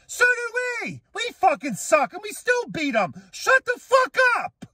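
high, escalating furious shout; furious yell. Real, not acted. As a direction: build up to a full angry scream. Angry rant peaks.

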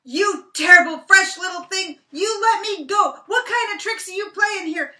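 very high, very loud outraged shout; furious Brer Rabbit. Acted. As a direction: rage at the tar baby's trick. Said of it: "He says".

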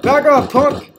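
tough shout. Acted. Player warns attacking enemy with mock tough shout. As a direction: bark it like a tough guy.

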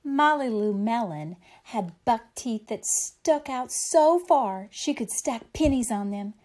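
usual speaking voice

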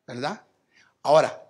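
usual speaking voice

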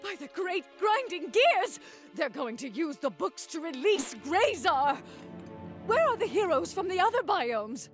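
high-pitched, theatrical panic; alarmed outburst. Acted. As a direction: exclaim in rising alarm at the danger. Realises Grayzar may be released.

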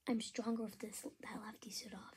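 menacing hushed whisper. Acted. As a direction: breathe the threat softly. Villain taunting in hushed tone.

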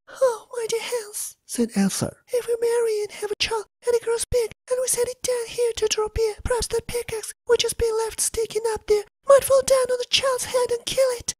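high, soft tearful voice; crying Elsa. Acted. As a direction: whimper it out, close to tears. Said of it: "lamenting and crying most pitifully".

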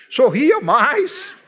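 drawn out slowly, with a laugh; smiling slowly. Shown as a demonstration. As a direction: stretch out "sorria mais" slowly, laughing. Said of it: "seja um pouquinho mais simpático".

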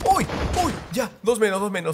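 high-pitched startled cheer; excited reaction. Real, not acted. Gamer counting kills mid-fight, excited. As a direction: react to a kill, pitch jumping up.

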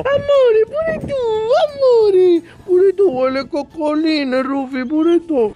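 very high squeaky pet talk. Real, not acted. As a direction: coo at the dog in a tiny voice. Cooing to pet dog.

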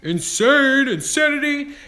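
very high, loud yelled words; excited scream. Real, not acted. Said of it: "it's hard for me to contain my excitement".

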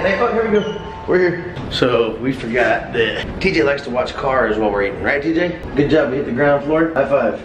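usual speaking voice

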